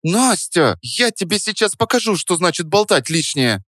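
high-pitched playful threat; feisty, high-voiced challenge; mock battle cry. A narrator's reading. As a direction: warn her in a high girlish voice, without shouting. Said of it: "с боевым кличем настоящей амазонки".

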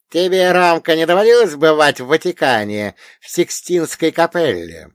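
high, loud elder quizzing a pupil; old storyteller pitched high and forceful; wise old dead man. Acted. Old man questions Romka.

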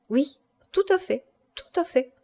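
light, high-pitched assent; amused confirmation. A narrator's reading. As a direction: confirm it playfully, still thinking it a joke. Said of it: "Elle crut encore qu'il trouvait la chose infiniment plaisante". (légère et amusée)

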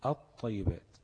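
الطيبات with silent ل and doubled ط; lam merged into ta. Shown as a demonstration. Teacher giving the result of merging, الطيبات with doubled ط. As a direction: lean into the held ط of at-tayyibat. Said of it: "قلبت اللام لطاء وادغمت الطاء".

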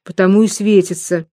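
plain reading voice

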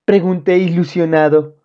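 ordinary delivery, nothing marked

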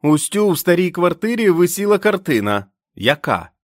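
high, loud call across the snow. A narrator's reading. The sentry calls a test question through the blizzard, high and loud. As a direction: test the stranger with a shouted question. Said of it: "гукнув".

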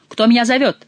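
loud call into the dark. Acted. Rider Schomberg calling out in the darkness, loud. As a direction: shout back, searching.

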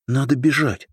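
put-on high, urgent frightened girl. A narrator's reading. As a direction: blurt it out in a sudden fright. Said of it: "испуганно заговорила она".

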